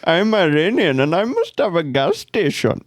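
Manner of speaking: hammy put-on Indian accent. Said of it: "I'd just do the Indian accent".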